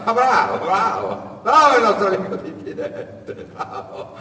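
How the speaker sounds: high, loud laughing bravo; the smuggler roaring with laughter at the wary boy; laughing mockery. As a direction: laugh in his face while praising. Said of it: "sbottò in una grassa e sonora risata".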